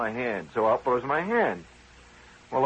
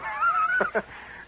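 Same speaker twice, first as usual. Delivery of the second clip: high laugh. Real, not acted. Speaker laughing at his own story, a high laugh.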